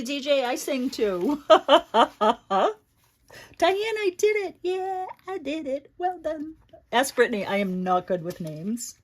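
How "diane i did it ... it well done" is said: proud and giddy. Real, not acted. Proud of remembering a name.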